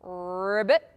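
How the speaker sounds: low, rolled frog croak; short trilled ribbit. Shown as a demonstration. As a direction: imitate a frog, rising at the end. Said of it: "my animal sounds".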